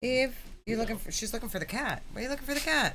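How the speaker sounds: high, cooing voice for the dog; pet talk voice. Real, not acted. Talking sweetly to the dog.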